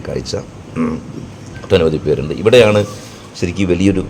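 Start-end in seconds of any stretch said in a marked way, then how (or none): none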